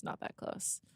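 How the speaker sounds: whispered under her breath; whispered aside. Real, not acted. Teasing herself to her co-host, whispered. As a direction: drop to a whisper for the repeat. Muttered repeat, self-correcting.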